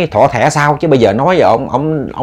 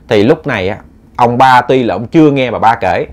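ordinary delivery, nothing marked

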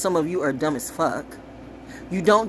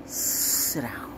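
hushed aside; 5 semitones lower, 7 dB quieter than usual. Real, not acted. Aside hushed to someone off-mic.

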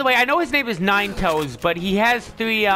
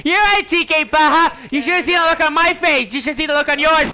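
high, raised mimicking retort; overdone high-pitched agreement; mock hilarity; 7 semitones higher, 4 dB louder than usual. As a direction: throw the joke back loudly. Throwing character's joke back at him.

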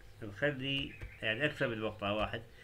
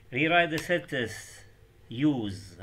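slow instruction read; 2 semitones higher, 7 dB louder, much slower than usual. Shown as a demonstration. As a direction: read the exam instruction aloud with emphasis. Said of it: "شو معنى rewrite؟". (قراءة التعليمات ببطء وبصوت عالٍ)

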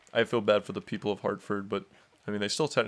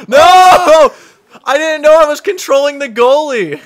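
excited yelling; 12 semitones higher, 14 dB louder than usual. Real, not acted. Goalie skates off by mistake.

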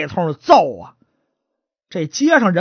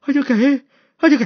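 higher-pitched acted stammer; stutterer; 7 semitones higher than usual. Stutterer called before the magistrate, stammering. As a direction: stick on the first words. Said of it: "这结巴过来了".

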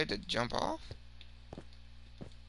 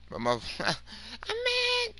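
laughing mock mad; much slower than usual.

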